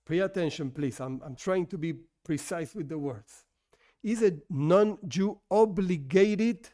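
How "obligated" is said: "obligated" said slowly and deliberately; stress on OBLIGATED. Shown as a demonstration. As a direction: lean on the word "obligated", drawing it out. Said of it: "stress this word".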